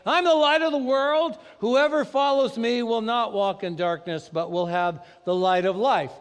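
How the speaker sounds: loud, high proclamation; preacher booming scripture; proclaimed. Acted. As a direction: ring it out to the room. Preacher proclaims Jesus' words.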